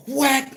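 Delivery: a high mock whack sound; cleaver whack. Splitting the coconut with the cleaver, sharp high whack sound.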